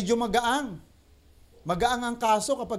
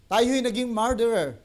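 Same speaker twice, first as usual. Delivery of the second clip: normal talking voice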